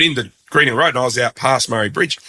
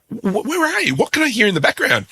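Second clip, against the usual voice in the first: mock baffled radio host, pitched way up; incredulous question; 12 semitones higher than usual. Radio host to caller, hearing pigs: incredulous high question. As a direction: imitate a bloke who can't believe his ears.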